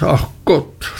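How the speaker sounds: „ach Gott“ leiser und abfallend nachgeklagt; leiser nachgeseufzt. A narrator's reading. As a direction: das Jammern verebben lassen. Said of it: "rief sie in ihrer kindischen Art". (leiseres, ermattetes „ach Gott“)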